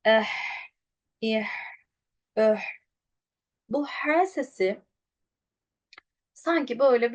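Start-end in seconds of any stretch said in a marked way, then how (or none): 0.1-2.6 "Eh, ih, öh" ending in a breathy throat h; short vowels, long airy h in "Eh, ih, öh"; voice and breath flowing. Shown as a demonstration. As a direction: let the breath run on after each vowel in "Eh, ih, öh". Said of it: "Ses ve nefes beraberce akacak".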